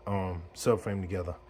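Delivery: ordinary talk, nothing marked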